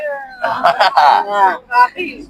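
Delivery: high-pitched burst of laughter; real, drawn-out high laugh. Real, not acted. Bursts out laughing in family banter.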